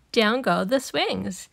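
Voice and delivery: ordinary delivery, nothing marked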